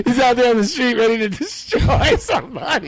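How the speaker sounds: high-pitched, breaking into laughter; laughing through the words, pitch climbing; real amused laughter. Real, not acted. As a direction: crack up while telling it. Cracking up over the prank.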